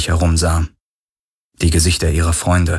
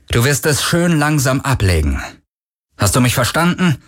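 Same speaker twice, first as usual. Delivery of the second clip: menacing order; 7 semitones higher than usual. A narrator's reading. Armed man threatens and demands obedience.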